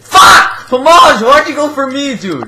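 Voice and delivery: loud, high burst of anger; explode, then demand an answer; frustrated yell. Real, not acted. Player killed by Pomage, angry shouting.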